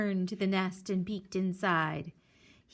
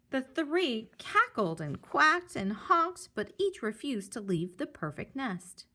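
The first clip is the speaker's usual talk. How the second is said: ordinary delivery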